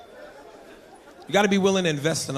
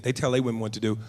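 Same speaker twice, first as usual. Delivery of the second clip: ordinary talk, nothing marked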